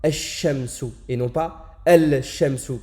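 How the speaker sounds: ash-shamsu sans lam, puis al-shamsu avec le lam, lentement; contraste ash-shamsu. Shown as a demonstration. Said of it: "on ne le prononce pas, on dira". (comparaison lente)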